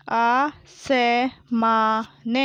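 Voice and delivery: आसमान drawn out as aa, sa, maa, na; very slow आसमान, each letter held apart; spelled out. Shown as a demonstration. Teacher sounding out आसमान for pupils, syllable by syllable. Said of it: "मैंने इस तरीके से पढ़ा".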